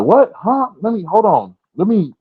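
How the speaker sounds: high, loud, flustered outburst; startled scrambling. Retold or imitated. Just-woken dad hearing Ron is there, startled. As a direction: imitate someone jolted into sudden alertness.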